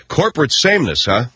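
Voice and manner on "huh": normal talking voice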